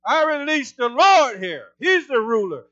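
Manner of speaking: loud, commanding proclamation; prophetic decree. Shown as a demonstration. Preacher releasing the Lord over government branches, decreeing. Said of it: "prophesy it, decree it".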